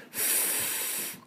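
slow ふーっ, unvoiced; voiceless f. Shown as a demonstration. Teacher letting students feel no vibration, voiceless f. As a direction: hold a silent f hiss. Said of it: "喉を触ってみてください".

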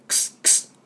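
two quiet k-s bursts without voice; voiceless X sound. Shown as a demonstration. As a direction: click a K straight into S. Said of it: "Xはクスっていうだけ". (息だけのクス、Xの音)

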